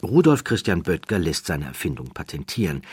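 ordinary delivery

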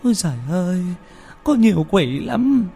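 keening, sing-song howl of despair; wailing. A narrator's reading. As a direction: wail loudly like a mother in grief, stretching the cries. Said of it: "Bà gào lên".

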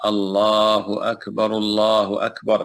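slow, stretched pair run together; joined takbirs. Shown as a demonstration. Teacher showing students the joined takbir, slow and drawn. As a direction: chant both takbirs in one slow breath. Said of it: "ويجوز أن يجمع التكبيرات".

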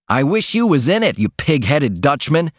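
a worker cursing his boss's shirt, hammed-up rage; mock shout. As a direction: stamp and yell at the shirt. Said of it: "he shouted".

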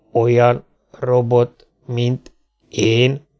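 slow robot question, word by word; halting machine voice; a baffled machine, gaps between words; talking robot. Acted.

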